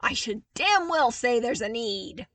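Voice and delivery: loud, heated retort. Acted. Zev arguing hotly with Luke, loud. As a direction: snap it back hotly. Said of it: "Zev argued hotly".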